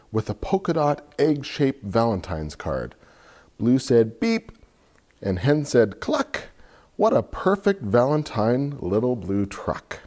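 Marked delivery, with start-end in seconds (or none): 4.2-4.5 truck beep. Acted. Truck honks in picture book. 6.0-6.5 hen cluck. Acted. Hen clucks in picture book.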